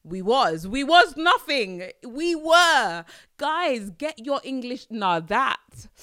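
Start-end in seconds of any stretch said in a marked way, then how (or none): 0.0-3.0 louder, higher, slow scornful "we was"; exasperated outburst. Retold or imitated. Venting at someone who says we was, exasperated. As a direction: blurt it out in disbelief. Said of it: "I'm like".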